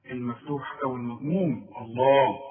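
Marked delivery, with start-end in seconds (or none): 1.8-2.4 الله with a thick emphatic l; heavy lam. Shown as a demonstration. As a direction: swell the l in الله. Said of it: "ما عدا إذا جت في لفظ الجلالة". (الله بلام مفخمة)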